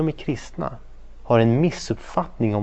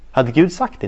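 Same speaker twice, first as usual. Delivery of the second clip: usual speaking voice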